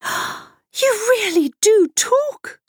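high, slow gasp of wonder; astonished cry. A narrator's reading. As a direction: cry out in amazement. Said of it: "cried the boy".

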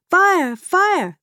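very high, loud alarmed shout. Acted. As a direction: yell it in alarm. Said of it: "the people leapt out of bed shouting".

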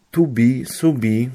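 subi with the o said close to u. Shown as a demonstration. Lecturer demonstrating Ukrainian vowel shift, apologising for own accent. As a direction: say To be and subi with o sliding toward u. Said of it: "przepraszam za moją wymowę ukraińską".

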